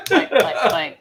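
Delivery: high, loud clink clink sound effect; mock clinking. GM imitating the mace knocking on the door, clinking.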